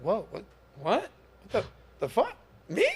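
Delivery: high, laughing, incredulous squeaks; hammed-up shock at crazy comments, laughing through it; mock disbelief. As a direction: play it up, baffled by each absurd comment. Baffled reaction to hateful comments.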